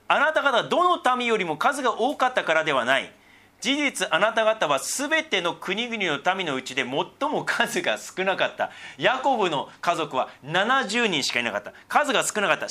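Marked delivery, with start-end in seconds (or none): 0.1-8.7 solemn Bible reading; scripture reading. Acted. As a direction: read it out like a lesson in church. Reads scripture aloud.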